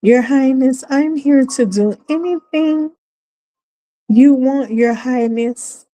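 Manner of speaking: simpering eager servant; mocking women who serve such men; mock servile woman. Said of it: "they're like".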